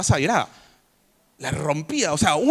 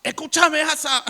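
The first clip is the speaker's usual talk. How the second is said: shouted; 11 semitones higher than usual. Retold or imitated. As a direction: yell it at the king. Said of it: "le gritó".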